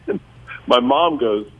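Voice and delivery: normal talking voice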